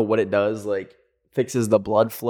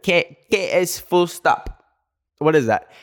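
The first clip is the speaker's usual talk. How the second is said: "K S full stop" said higher and slowly, drawn out in confusion; confused echo; 6 semitones higher, slower than usual. Retold or imitated. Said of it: "Uh, like".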